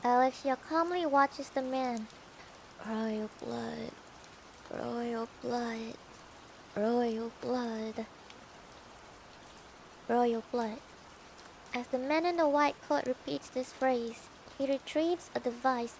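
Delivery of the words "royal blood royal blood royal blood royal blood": slow, monotone zombie chant. Acted. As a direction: drone it like a zombie. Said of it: "He sounds like a zombie".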